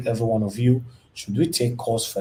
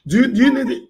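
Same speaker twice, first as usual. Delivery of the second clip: raised, high-pitched pleas over a caller's crying; loud consoling; 7 semitones higher, 8 dB louder than usual. Real, not acted. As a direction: urgently talk a crying caller down. Host urges sobbing caller to stop.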